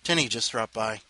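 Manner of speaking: normal talking voice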